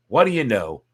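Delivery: ordinary delivery, nothing marked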